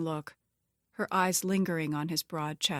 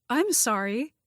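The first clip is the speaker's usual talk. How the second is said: higher, mildly flustered woman's voice; a touch of embarrassed stammer, pitched up; stammered apology; 6 semitones higher, 4 dB louder than usual. A narrator's reading. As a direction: apologize as if caught intruding. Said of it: "she stammered".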